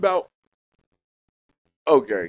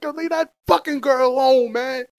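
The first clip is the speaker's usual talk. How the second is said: telling him off; 7 semitones higher, 5 dB louder than usual. Real, not acted. Telling off the creep.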